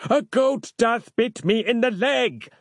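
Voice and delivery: high, drawn-out cry of pain; hammed-up pained character voice; wincing in pain. A narrator's reading. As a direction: wail melodramatically over a small wound. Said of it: "he winced".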